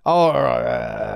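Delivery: low, drawn-out mock fizzle; imitating a dying attempt. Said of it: "you see his eyes die a little bit".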